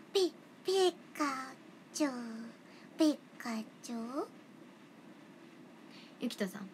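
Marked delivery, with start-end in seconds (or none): 0.1-4.3 Pikachu impression. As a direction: say it like the little electric mouse.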